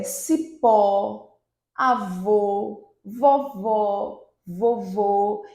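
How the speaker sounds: "cipó", "avô": final vowel strong and drawn out; stress on last syllable. Shown as a demonstration. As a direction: say "vovó" and "vovô" one at a time, leaning on the ending. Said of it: "a última sílaba mais forte". ("cipó", "vovó", "vovô": final forte e prolongado)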